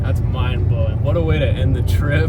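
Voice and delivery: excited. Real, not acted. Excited about the launch.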